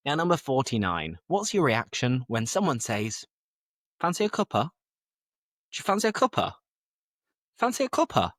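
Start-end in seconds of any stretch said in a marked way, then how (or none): 4.0-8.3 high, chirpy put-on offer; sing-song, pitched-up invitation; tea offer. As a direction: act out someone offering a cup of tea. Acting out someone offering tea.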